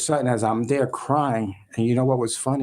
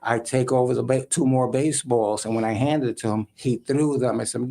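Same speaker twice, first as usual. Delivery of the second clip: usual speaking voice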